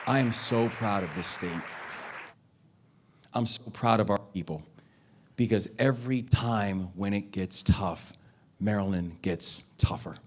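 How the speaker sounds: rally speech. Real, not acted. Closing rally thanks.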